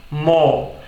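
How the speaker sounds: "mo" with no R at the end, just M and O; British no R. Shown as a demonstration. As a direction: drop the R in "mo", like a cow's moo. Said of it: "coisas como mo". ("mo" sem R, britânico)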